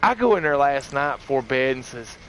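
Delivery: usual speaking voice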